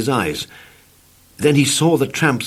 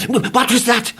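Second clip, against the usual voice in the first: high frightened shriek; 13 semitones higher than usual. Acted. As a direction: shriek in alarm. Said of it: "he shrieked".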